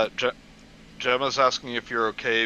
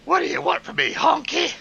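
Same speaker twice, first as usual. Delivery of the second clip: high, loud cartoon crow voice, way up in pitch; Dumbo crow impression; 5 dB louder than usual. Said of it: "trying my best to sound like fucking crows from Dumbo".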